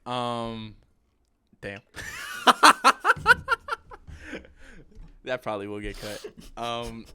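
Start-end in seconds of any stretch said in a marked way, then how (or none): 1.9-5.2 high, loud burst of laughter; embarrassed laugh. Real, not acted. Speaker laughs at losing their point mid-recording, loud high laugh. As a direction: break into a big high-pitched laugh. Loses track, laughs at own blunder.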